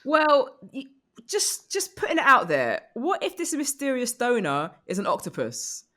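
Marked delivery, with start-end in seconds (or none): none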